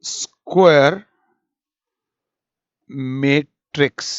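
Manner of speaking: Square drawn out slowly on a rising tune, then matrix dropped; slow dramatic reveal. Shown as a demonstration. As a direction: unveil square matrix word by word with long pauses. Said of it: "जिसको बोला".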